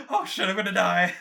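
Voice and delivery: high, loud, terrified cry; panicked. Acted. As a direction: wail like you're dying. Character stabbed, panicking.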